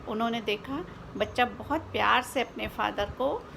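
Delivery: plain reading voice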